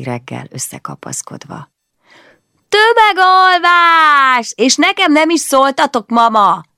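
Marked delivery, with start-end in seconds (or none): 2.7-6.6 put-on excited child shout. Child bursts in on sleeping family.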